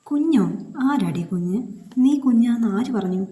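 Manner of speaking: loud, high incredulous retort; sharp demand. A narrator's reading. Alex confronts Linda over calling herself a baby: loud sharp retort. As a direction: bark the question back at her. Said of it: "ഒരു ഭാവവ്യത്യാസമില്ലാതെ ചോദിച്ചു".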